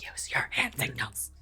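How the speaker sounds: low whisper. Acted. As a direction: whisper the instruction. Keeping quiet near goblins.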